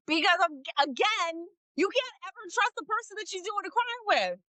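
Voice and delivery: high laughing rant. Real, not acted.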